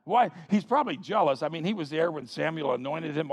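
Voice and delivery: ordinary delivery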